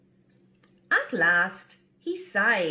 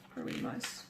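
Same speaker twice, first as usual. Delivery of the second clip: soft whisper; whispered sympathy; 10 dB quieter than usual. Real, not acted. Narrator pitying the mouse, whispered. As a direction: breathe it with pity.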